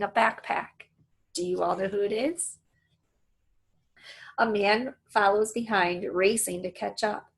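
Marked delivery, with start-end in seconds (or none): none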